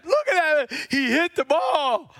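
high, teary voice of pride; tearful proud dad. Retold or imitated. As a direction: choke up with pride over the hit. Dad near tears with pride.

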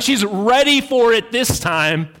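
ordinary talk

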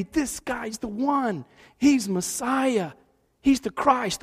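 usual speaking voice

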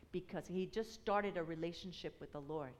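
ordinary delivery

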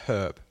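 HERB with a sounded h; low, soft "herb" with h; British herb. Shown as a demonstration. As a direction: start Herb with a clear h. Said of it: "I would say herb".

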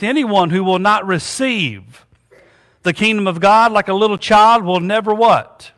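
ordinary delivery

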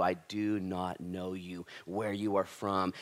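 low, slow, grave words of the master; slow solemn verdict. Acted. The master turning away the knockers, low and slow. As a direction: let it land slowly and heavily.

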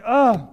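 loud, high groan of disgust; exasperated groan. Retold or imitated. Father seeing the broken iPad, loud exasperated groan. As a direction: groan like a father going ballistic. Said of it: "and the father goes ballistic".

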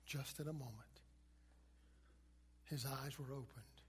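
very soft, low, near-whispered wonder; hushed awe. Retold or imitated. Preacher telling of a blind man first seeing, hushed awe. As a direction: let each new sight land in a hush, with long pauses.

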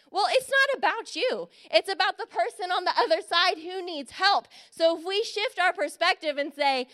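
ordinary delivery

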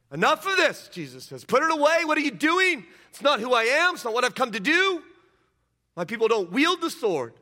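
stern rebuke. Acted. Jesus rebuking Peter for swinging the sword, loud stern rebuke. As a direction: stop him cold with each line.

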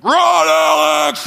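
high, drawn-out holler from the stands; loud cheering yell. Retold or imitated. Excited parent cheering on a daughter in a race, loud yell. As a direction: bellow it across a stadium, stretched out. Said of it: "my voice is like".

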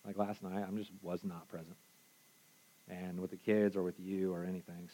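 soft, hushed, sorry confession; quiet apology. Retold or imitated. Texting his wife Jen an apology, softly sorry. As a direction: murmur it like a sincere apology. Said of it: "I had to send Jen a text".

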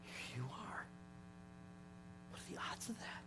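very soft, breaking into high, breathy wonder; hushed amazement. Retold or imitated. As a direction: imitate her marvelling, barely above a whisper. Nurse voiced marvelling at finding him.

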